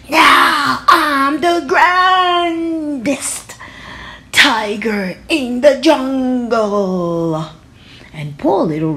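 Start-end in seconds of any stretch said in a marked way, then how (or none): 0.1-7.4 slow, higher, pompous boast; boastful tiger. Acted. Tiger boasting as he struts away, slow and pompous. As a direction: drawl the boast grandly.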